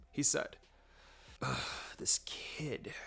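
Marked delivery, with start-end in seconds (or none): 1.4-2.9 hushed, fed-up mutter; exasperated whisper. Acted. Babysitter grumbles about the stubborn boy, whispered. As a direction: breathe it under your breath, annoyed.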